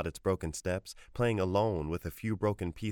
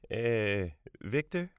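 grandmother's question; 4 semitones higher, much slower than usual. A narrator's reading. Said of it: "she asked".

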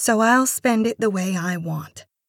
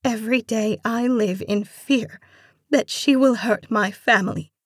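higher, distressed plea; lamenting; 5 semitones higher, 3 dB louder than usual. A narrator's reading. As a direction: lament your plight, fearful for family. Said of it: "she lamented".

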